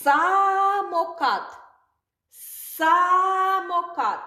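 slow drawn-out teaching word; slow model word. Shown as a demonstration. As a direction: stretch out "Самокат" for the children. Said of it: "teacher models word slowly".

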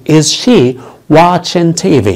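drawn out word by word; slow teaching model of Is she watching TV; slow demo. Shown as a demonstration. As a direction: say it slowly for the class. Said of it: "هل هي بتشاهد التلفزيون؟".

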